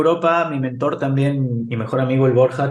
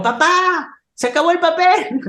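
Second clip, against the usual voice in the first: put-on high child's call to his father; little Tiago calling out for his dad. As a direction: pipe it up like a small boy.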